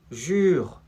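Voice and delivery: usual speaking voice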